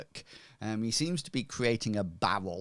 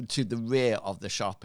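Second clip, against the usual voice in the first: ordinary talk, nothing marked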